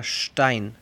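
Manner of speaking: "Stein" with initial sh, "shtain"; German "Stein" opening on sht; S como sh. Shown as a demonstration. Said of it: "la S cambia su pronunciación". ("Stein" pronunciado "shtain")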